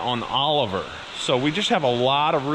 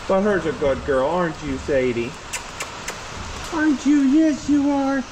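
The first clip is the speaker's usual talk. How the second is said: high sing-song praise for a dog; 4 semitones higher than usual. Real, not acted. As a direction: coo at the puppy.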